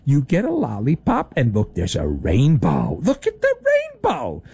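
sing-song voice for a toddler being distracted; mock kiddie voice. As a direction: coo like you're soothing a child with treats. Mocking team media as kids' talk.

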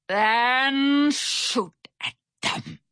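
very slow, drawn-out growled order; angry growl. A narrator's reading. Barracuda Bill orders cannon fire, growling angrily. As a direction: growl it out one word at a time. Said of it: "Barracuda Bill growled".

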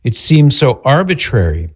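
ordinary talk, nothing marked

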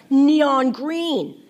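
high, loud amazement; excited exclamation. A narrator's reading. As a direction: blurt it out, thrilled by the sight. Excited boy reporting electric eels.

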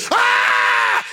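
high shouted ah; mock scream. Preacher acting out the screaming in the elevator, shouted scream. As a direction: scream like someone trapped. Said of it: "The screaming".

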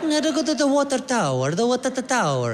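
put-on high, halting Italian accent; mock Italian tourist fumbling for English. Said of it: "cute little Italian couple that's like".

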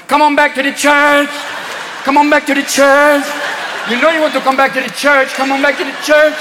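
high, rhythmic samba chant; samba nuns. Acted. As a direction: coax them back with a samba lilt. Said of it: "samba nuns in the thongs going".

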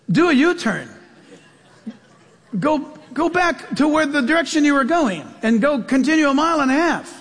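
half-hearted robotic direction voice, chuckling; GPS girl voice. Retold or imitated. Pastor mimicking GPS telling him to turn back, mild mock sat-nav. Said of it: "it's in a girl's voice".

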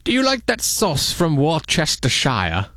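posh British voice, drawn out; British accent. Pretend. Host asks if friend likes the sauce, in a slow British accent. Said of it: "you have to say it like that".

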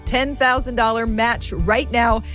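ordinary talk, nothing marked

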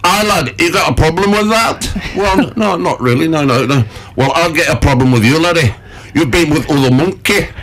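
put-on high puppet voice in a broad northern English accent; a cheeky puppet monkey with a northern English accent, challenging. Puppet monkey growling at its owner, northern English accent. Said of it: "started speaking with northern accent".

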